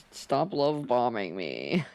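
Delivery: ordinary talk, nothing marked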